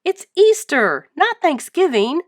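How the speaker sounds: high, loud cartoon sponge voice, slowed; exasperated SpongeBob. A narrator's reading. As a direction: correct him with a squeaky huff. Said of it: "said SpongeBob".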